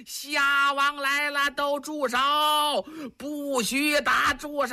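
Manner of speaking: long-drawn, high-pitched herald's call; high shout. Acted. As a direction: call it out across a battlefield, stretching each word. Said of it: "有人高喊呐".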